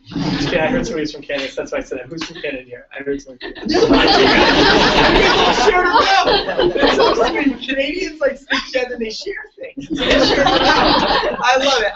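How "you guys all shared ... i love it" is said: high, laughing delight. Real, not acted. Speaker laughs at Canadians sharing in the audience, laughing. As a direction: talk through the giggles.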